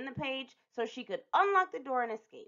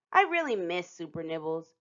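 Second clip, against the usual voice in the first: ordinary delivery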